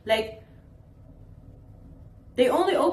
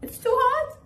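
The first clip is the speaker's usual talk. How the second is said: incredulous; 14 semitones higher than usual. Real, not acted. Incredulous echo of parent's excuse.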